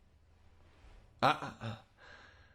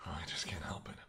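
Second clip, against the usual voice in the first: whisper; 5 semitones lower, 11 dB quieter than usual. Acted. Feeding while talking.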